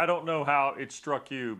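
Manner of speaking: ordinary delivery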